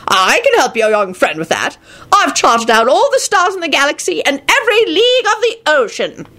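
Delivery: put-on high, self-important old wizard voice; boastful old wizard. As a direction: boast like an ancient mapmaker.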